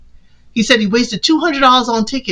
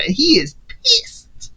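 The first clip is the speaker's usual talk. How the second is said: slow, quiet, weighty delivery; drawn-out dramatic beat; slow dramatic emphasis; 3 semitones higher, 3 dB quieter, much slower than usual. Real, not acted. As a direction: let it land slowly.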